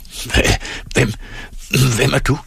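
hoarse, halting question. A narrator's reading. Old ferryman challenges a figure in the dark, hoarse and halting. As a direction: stammer it out, hoarse from long silence. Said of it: "Hæs lød Karons røst".

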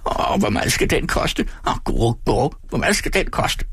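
high greedy wail with a throaty rattle; whining old man. A narrator's reading. Old shopkeeper asks the boy the price, wailing. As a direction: whine the question like a crazed miser. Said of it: "udtalte hver sætning nøjagtigt på samme måde".

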